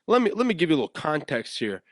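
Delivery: usual speaking voice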